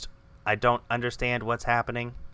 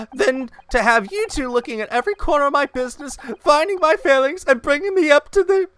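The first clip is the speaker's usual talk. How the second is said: high, loud, overwhelmed pleading; distressed outburst; 12 semitones higher, 7 dB louder than usual. Acted. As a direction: at the end of her rope, voice rising. Character overwhelmed, asks them to leave.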